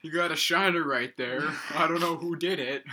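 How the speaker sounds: high, loud, fake-innocent put-on voice; mock innocent student. Mock greeting to injured teacher.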